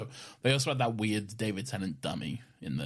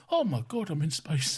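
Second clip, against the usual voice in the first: put-on a higher, wide-eyed old granddad in awe; old man voice; 6 semitones higher than usual. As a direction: play an amazed old man seeing space for the first time. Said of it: "I was just doing a, an old man".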